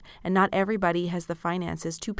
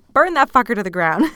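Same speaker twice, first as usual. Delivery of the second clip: gleeful outburst; 4 semitones higher, 11 dB louder than usual. Real, not acted. Laughing rant about perfectionism.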